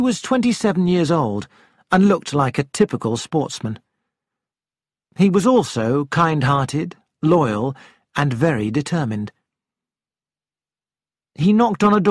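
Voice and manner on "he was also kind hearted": usual speaking voice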